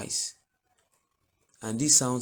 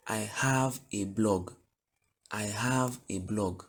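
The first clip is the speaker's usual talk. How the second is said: slow model sentence; slower than usual. Shown as a demonstration. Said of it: "teacher models sentence slowly".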